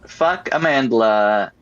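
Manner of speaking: normal talking voice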